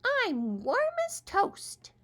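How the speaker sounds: very high, slow drowsy squirrel; sleepy little voice. Acted. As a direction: murmur like a tiny sleepy critter. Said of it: "he said in a sleepy little voice".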